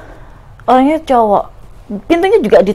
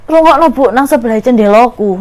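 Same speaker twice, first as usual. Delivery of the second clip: frightened urging; 3 dB louder than usual. Retold or imitated. Scared daughter urging mother to listen, frightened.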